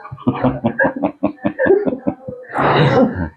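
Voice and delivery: long, voiceless chuckling; drawn-out real laugh; laughter. Real, not acted. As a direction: laugh helplessly at your own joke. Laughing at own joke in session.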